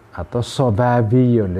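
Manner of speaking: ordinary talk, nothing marked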